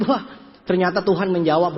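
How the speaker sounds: usual speaking voice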